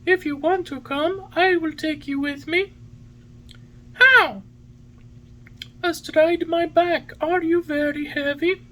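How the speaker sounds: very high bird voice; pigeon voice. A narrator's reading. Pigeon offers Pinocchio a ride on his back, in pigeon voice.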